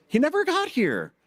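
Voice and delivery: high, excited aside; teasing the host about the board, high and bright; playful exclamation. Real, not acted. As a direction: toss it out brightly to the crowd. Joking aside to audience.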